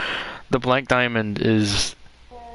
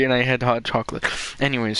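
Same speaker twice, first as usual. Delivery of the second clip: normal talking voice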